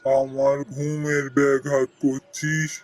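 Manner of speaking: a drowsy tree disturbed from sleep; talking tree. Acted. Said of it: "গাছের তলায়".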